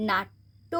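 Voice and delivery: নাট্য with the ট doubled, said as naatto; doubled consonant. Shown as a demonstration. Teacher demonstrating নাট্য to students: doubled t. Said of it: "দটাকে আমরা দুবার উচ্চারণ করব".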